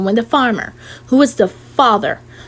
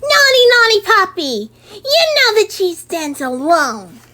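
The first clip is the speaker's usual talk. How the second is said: playful scolding; 7 semitones higher than usual. Acted. Child scolding the dog.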